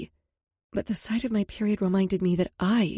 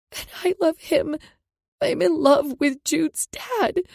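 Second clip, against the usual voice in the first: tearful confession; 8 semitones higher, 5 dB louder, slower than usual. Acted. Crying confession after tears.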